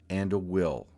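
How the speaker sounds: ordinary talk, nothing marked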